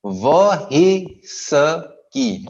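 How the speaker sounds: व ही स की sounded out slowly, piece by piece; audible h sound. Shown as a demonstration. Teacher spelling out sounds, व ही स की with clear h. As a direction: break whiskey into व ही स की, h heard. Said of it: "ह की आवाज जरूर आएगी".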